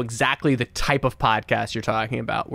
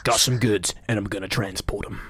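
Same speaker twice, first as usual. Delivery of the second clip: lower, clipped tough-guy voice; a low hard-man mutter; Jason Statham impression; 5 semitones lower, 4 dB quieter than usual. Playing Jason Statham's transporter for Chris, low tough voice. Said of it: "That is more of a Jason Statham".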